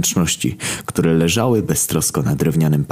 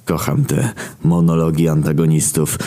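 ordinary delivery

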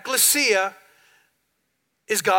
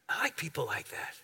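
a hushed whisper repeating his own line; 7 semitones lower, 14 dB quieter than usual. Retold or imitated. Pastor retelling his reply about cussers, whispered. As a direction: drop to a secret whisper, like a confession.

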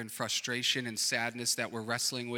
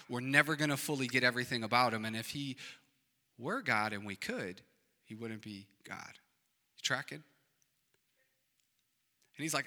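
ordinary talk, nothing marked

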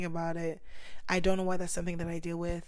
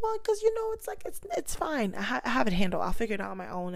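high breezy brush-off; 5 semitones higher, 3 dB louder, faster than usual. Retold or imitated. To her mentors, insisting she's fine, high and breezy. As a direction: wave away their concern in a falsely light voice. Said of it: "I'm like".

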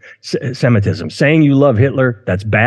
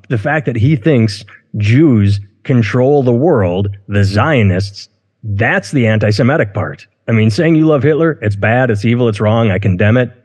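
ordinary delivery

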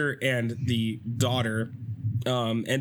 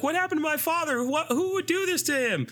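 put-on high, pleading young woman's voice; worried daughter. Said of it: "she's like".

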